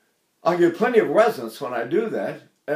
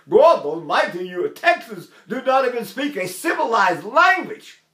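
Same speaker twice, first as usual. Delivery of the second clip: raised, higher-pitched mock scolding; exasperated quote; 6 semitones higher than usual. New York teacher scolding a Texan student, mock outrage.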